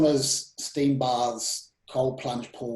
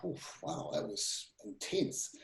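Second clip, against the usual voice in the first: whispered, stunned, almost no voice; breathless whisper; 4 semitones lower, 13 dB quieter, slower than usual. Retold or imitated. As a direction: let it out on a hushed breath, overwhelmed. Retelling being overwhelmed at cafe.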